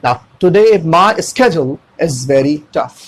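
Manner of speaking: very slow, word by word; drawn out slowly for learners; slow demo. Shown as a demonstration. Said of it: "कहीं पे slow करना पड़ता है".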